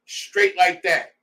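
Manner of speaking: ordinary talk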